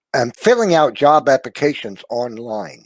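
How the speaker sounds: ordinary delivery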